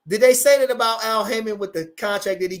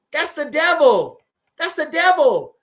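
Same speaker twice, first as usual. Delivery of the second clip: emphatic loud; 8 semitones higher, 6 dB louder, slower than usual. Real, not acted. Repeats phrase plain, then punched.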